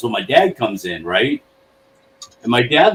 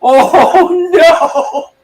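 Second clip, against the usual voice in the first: much higher, louder, laughing dread; mock panic; 9 dB louder than usual. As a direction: squeal it like you're doomed. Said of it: "I was like".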